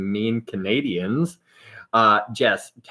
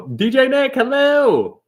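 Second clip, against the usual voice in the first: higher, louder sing-song hello; bright welcoming call; excited greeting; 7 dB louder, slower than usual. Real, not acted. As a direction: call out to the viewer with glee.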